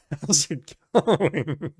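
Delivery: slow, laughing through the words; giggling at the awkward line. Real, not acted. Laughing at tactless reply.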